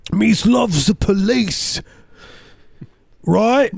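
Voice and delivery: high, slow, hammed-up Cockney; mock Cockney. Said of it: "it needs to be more Cockney".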